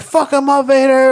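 high, loud heckling yell; mock crowd yell. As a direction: cheer on the villain from the seats. Audience yelling at fight.

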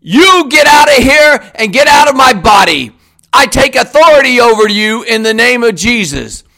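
normal talking voice

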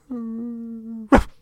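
high imitated dog whine; dog whimper. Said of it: "you can see him going".

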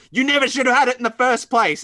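high, loud retold outburst; mock angry scolding. Acted quarrel between comic characters.

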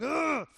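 mock frustrated grunt of a dad; man noise grunt. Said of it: "we just make man noises".

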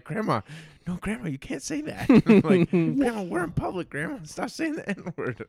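high pleading scold of grandma; mock horrified grandson; exasperated grandson. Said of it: "And he's like".

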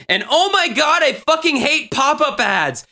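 high-pitched acted tirade; hammed-up exasperated outburst; furious rant. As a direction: read it like a raging, fed-up teenager. Reading out rant about pop-up ads.

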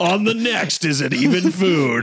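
hammed-up next-episode announcer; big mock teaser voice; mock TV promo. Mock promo for food show.

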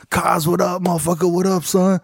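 ordinary talk, nothing marked

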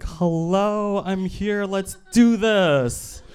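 high, excited breathy voice, slow on the end; a way too eager character popping up; impression of his eager dick. Eager body part greeting its owner, high and excited. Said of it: "that is a very good impression of my dick".

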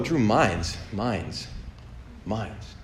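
trailing off softly while trying to place a name; imitating someone vaguely recognizing a name; searching memory. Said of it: "kinda searching for, like, that seems important".

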